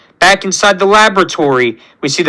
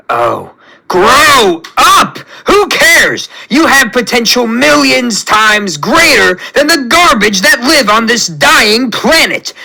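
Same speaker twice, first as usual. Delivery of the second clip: high, exasperated villain rant; explode, then command like an angry god; drawn-out cry into heated tirade; furious tyrant outburst; 4 semitones higher, slower than usual. Acted. Tyrant rages at rebelling creation.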